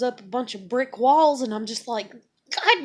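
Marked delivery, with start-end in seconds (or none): 2.5-2.9 sudden high, loud burst of frustration; exasperated outburst. Acted. Woman venting at walls others put up, exasperated burst. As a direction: blow up at being blocked. Acts hitting brick walls.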